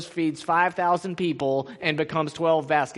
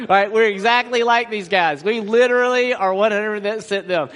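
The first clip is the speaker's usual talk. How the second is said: ordinary talk, nothing marked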